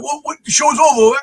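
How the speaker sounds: badly faked British accent, puzzled. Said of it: "I can't do the accent".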